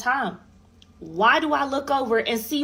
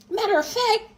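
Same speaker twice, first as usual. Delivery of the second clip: indignant; 12 semitones higher than usual. Retold or imitated. Escalating rant at ex.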